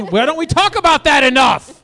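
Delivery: high, shouted comic rant; over-the-top indignant shout; mock outrage. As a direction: yell it as a burning grievance.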